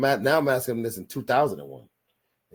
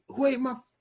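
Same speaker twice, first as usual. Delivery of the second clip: mock angry yell; 13 semitones higher than usual. Stepdad bursting in, accusing.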